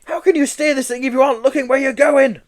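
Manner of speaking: loud high exasperation; exasperated Joe. Acted. Joe frustrated with the hiding Yeti, loud high. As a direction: shout at him in frustration.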